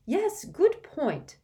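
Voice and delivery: high, slow motherly agreement; mother's voice. Acted. Mrs Weasley agrees with Fleur, high and drawn-out. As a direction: draw it out approvingly.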